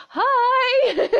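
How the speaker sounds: squealed hello that tips into laughter; gushing greeting. Acted. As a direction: stretch out a high-pitched, giddy hello. Acting out an excited greeting.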